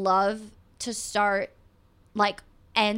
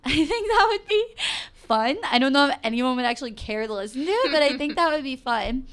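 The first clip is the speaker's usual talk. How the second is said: laughing; 4 semitones higher, 4 dB louder than usual. Real, not acted. Laughing at her own idea.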